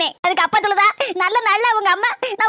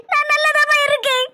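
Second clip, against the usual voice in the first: crying; 4 semitones higher than usual. Acted. Daughter weeping to mother.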